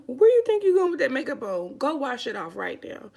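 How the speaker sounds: loud, high scolding uncle, easing off at the end; mock stern uncle. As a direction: bark it like a strict uncle catching her. Uncle scolding her over makeup.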